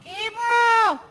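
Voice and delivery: put-on high kid's shout; calling out. Stepson calling him awake at dawn, high shout. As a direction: wake him up like an eager kid.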